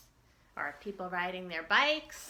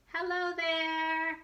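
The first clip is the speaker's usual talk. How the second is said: friendly greeting; 9 semitones higher, slower than usual. Acted. Waving hello in story.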